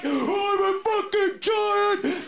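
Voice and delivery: high, drawn-out giant's roar; boastful giant. Acted. Planet Jupiter boasting to the others, slow giant shout. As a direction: bellow it slowly as a huge planet. Voices Jupiter as the big one.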